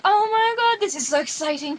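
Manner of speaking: high, loud gush; hammed-up retold thrill; mock excited squeal. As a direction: act out over-the-top excitement, high and loud. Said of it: "and I was like".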